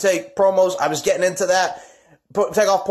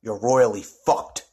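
slow low threat; 7 semitones lower, slower than usual. Acted.

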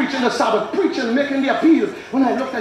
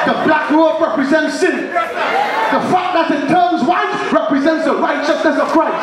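raised preaching voice, slowing on the key line; 4 semitones higher than usual. Acted. Preacher interpreting a dream to the congregation, preaching. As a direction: proclaim it to the congregation.

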